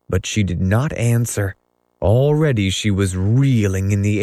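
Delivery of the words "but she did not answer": ordinary delivery, nothing marked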